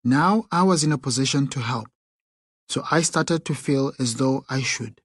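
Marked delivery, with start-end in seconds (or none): none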